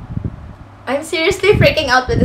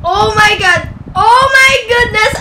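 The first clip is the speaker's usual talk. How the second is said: high, loud tearful cry; overjoyed, tearful exclaiming; overwhelmed, near tears; 8 semitones higher, 7 dB louder than usual. Real, not acted. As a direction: wail it in disbelief. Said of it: "Naiiyak talaga ako".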